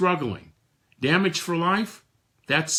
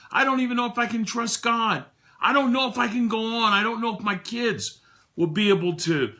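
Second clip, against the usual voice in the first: higher, rising despair, rushing at the end; despairing younger self; 8 semitones higher than usual. Retold or imitated. His grieving 1996 self confessing he can't go on, despairing. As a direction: pour out hopelessness, building. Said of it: "here would be my answer".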